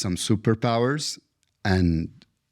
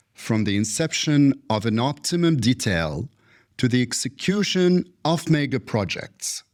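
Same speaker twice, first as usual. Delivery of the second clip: formal introduction; 5 semitones higher than usual. Acted. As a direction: present the guest like a gala host. Host reads formal guest introduction.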